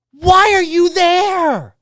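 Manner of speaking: normal talking voice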